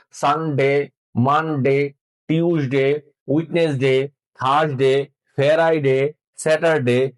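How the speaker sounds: সানডে to স্যাটারডে with short level "de" endings; common Bengali-style pronunciation. Shown as a demonstration. Teacher shows how learners usually say সানডে to স্যাটারডে, Bengali-style pronunciation. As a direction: say ওয়েডনেসডে as "wed-nes-de", Bengali sounds throughout. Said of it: "সাধারণত আমরা এগুলোর উচ্চারণ করি".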